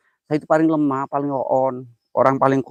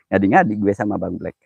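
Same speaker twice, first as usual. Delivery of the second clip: ordinary talk, nothing marked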